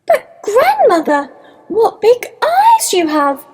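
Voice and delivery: put-on high-pitched child voice, amazed; wondering little girl. A narrator's reading. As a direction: pitch it up like a wide-eyed girl. Little girl marvels at the wolf.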